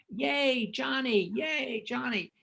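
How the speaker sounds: high cheering call of the name. Retold or imitated. Assistant director calling out a cue.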